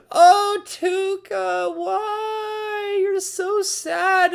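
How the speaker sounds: high, wailing mock cry ending in a shout; mock sobbing. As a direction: overdo the heartbroken whimper, then cry out. Gushing over sad character's story.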